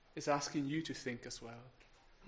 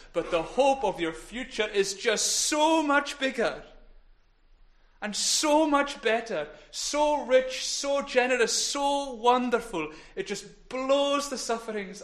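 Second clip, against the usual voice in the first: louder and higher, each phrase hit harder; rising preaching fervor; 8 semitones higher, 8 dB louder than usual. Real, not acted. Preacher to congregation about future hope, soaring fervor. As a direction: swell up with joy, slowing on the last phrase.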